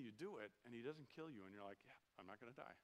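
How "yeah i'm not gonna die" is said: hushed, smug relief; soft, quietly triumphant kid; a little boy whispering his escape; kid's relief. Retold or imitated. Kid relieved after sinning.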